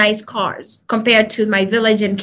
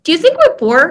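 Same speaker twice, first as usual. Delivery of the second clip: put-on higher and louder, a kid asking innocently; curious child's question; 6 semitones higher, 5 dB louder than usual. Friend asks the sister if they are poor, in a high, innocent voice. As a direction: ask like a wide-eyed young friend.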